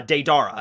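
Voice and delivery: Deidara said day-DAR-uh; Deidara with a long "dar"; habitual mispronunciation. Shown as a demonstration. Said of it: "I just kept calling his name".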